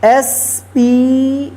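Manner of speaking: letters spelled one by one, very slow; spelling out letters. Shown as a demonstration. Teacher spelling a word aloud slowly. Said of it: "spare".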